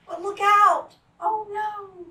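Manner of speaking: high, loud cry of warning; alarmed warning. Acted. Voice warns frog of danger, high alarmed cry. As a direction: warn the frog in a rush.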